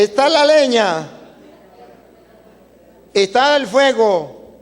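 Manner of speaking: slow, puzzled listing; slow wondering child. Acted. Isaac asking his father Abraham on the way, slow and wondering. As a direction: spell it out slowly, wondering.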